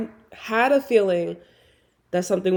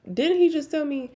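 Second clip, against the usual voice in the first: suspicious disbelief; 9 semitones higher than usual. Retold or imitated. Suspicious inner thought.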